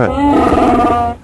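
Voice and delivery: a long, high, slow Wookiee howl on the words; Chewbacca roar. Acted. Cohost performs Chewbacca on the host's request, long high howl. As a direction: stretch it out like Chewbacca roaring. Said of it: "just do it one for us".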